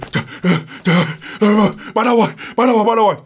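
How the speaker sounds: imitated jolting awake, words in gasping bursts; startled awakening. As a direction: come to with a start, calling out in fright. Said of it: "trước khi tỉnh lại còn giật mình".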